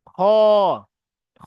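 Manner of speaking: খ with lips rounded into a circle; rounded kha. Shown as a demonstration. Teacher demonstrating খ to students, lips rounded. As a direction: round the mouth fully on খ. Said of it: "এই খ পড়ছি মুখ গোল হয়ে যাচ্ছে".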